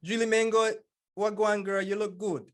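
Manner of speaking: an American trying out patois; attempted patois. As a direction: copy the Jamaican greeting. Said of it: "I gotta work on it".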